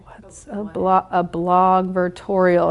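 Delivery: puzzled, slow drawn-out question; confused, slow question. Retold or imitated. Consultants' jargon baffles her, slow puzzled question. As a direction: sound out the strange word slowly, baffled.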